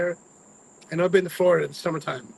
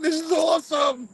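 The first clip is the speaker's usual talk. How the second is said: much higher, loud joyful shout; excited shout; 13 semitones higher, 3 dB louder than usual. Retold or imitated. At the park gates, a high joyful shout. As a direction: yell it like a kid at Disney. Sudden delight at the sign.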